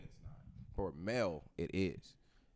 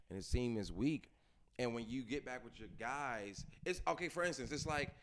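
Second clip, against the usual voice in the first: ordinary talk, nothing marked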